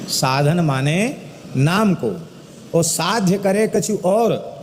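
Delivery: slow, drawn-out couplet recitation; दोहा पाठ. Acted. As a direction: recite the verse slowly, stretching the words. (धीमे, खींचकर दोहे का पाठ)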